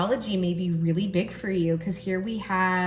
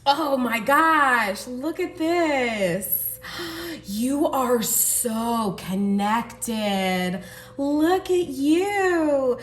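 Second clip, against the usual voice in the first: slow, stretched, louder gasps of delight; excited gushing; 4 semitones higher, 4 dB louder, much slower than usual. Real, not acted. Reader gushing to the viewer over their card, excited. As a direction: marvel at the card as if it stunned you.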